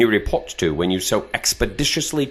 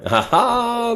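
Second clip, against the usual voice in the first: mocking laugh. Captor mocks sudden realization.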